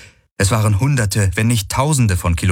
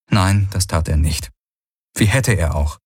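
ordinary delivery, nothing marked

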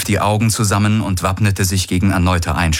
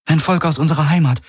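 higher and fast female voice; Kirai's voice; 10 semitones higher, faster than usual. A narrator's reading. Kirai eagerly explains the Golvonen to Hawks, higher and fast. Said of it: "sagte Kirai".

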